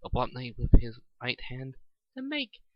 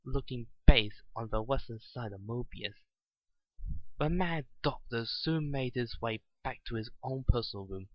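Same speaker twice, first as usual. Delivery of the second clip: plain reading voice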